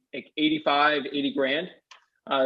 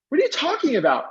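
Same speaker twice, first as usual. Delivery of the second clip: pitched way up in disbelief; incredulous; 10 semitones higher, 3 dB louder than usual. Retold or imitated. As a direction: throw it back at him, baffled and high. Teen shocked at legal news.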